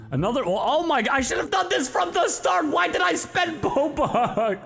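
high, rushed shout of delight; excited shout. Real, not acted. Gamer thrilled by free arrows from viewers, shouting. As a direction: yell it out thrilled.

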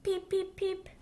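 high squeaky little duckling peeps; baby duck peeping. Acted. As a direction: chirp it out high like a tiny duck. Baby duck's peeps in story.

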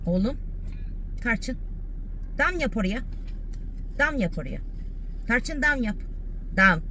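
high, soft coaxing for a bark; coaxing dog. Real, not acted. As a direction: sweet-talk the dog into barking.

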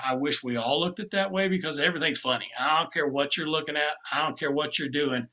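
normal talking voice

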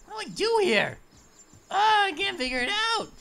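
loud panicked cry; frustrated shout. Real, not acted. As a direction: yell it in frustration.

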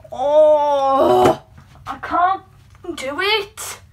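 loud, high despairing cry; frustrated wail. Real, not acted. Frustrated with curling.

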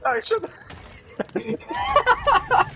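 mock high squeal. Mock reply to taunt.

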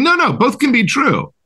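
high-pitched, insistent objection; pointing out. Acted. Pointing and saying it back to deflectors, insistent. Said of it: "pointing and saying".

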